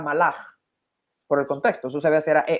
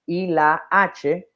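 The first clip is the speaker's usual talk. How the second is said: slow letter spelling; 3 semitones higher, much slower than usual. Shown as a demonstration. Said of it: "¿cómo se pronuncia eso?".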